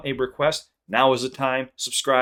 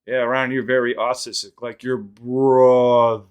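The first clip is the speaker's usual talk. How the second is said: "brother" dragged out very slow and louder; slow-motion replay; 2 semitones higher, 7 dB louder, slower than usual. Retold or imitated. Dad blurting it at the cousin, slow-motion voice. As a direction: stretch "like your brother" like a slowed tape. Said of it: "everything moved in slow motion".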